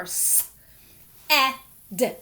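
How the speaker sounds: "s, eh, d" as three separate sounds; sounded out by sound. Shown as a demonstration. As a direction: sound out "s, eh, d" with a gap after the hissed s. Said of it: "pronounced as a eh sound".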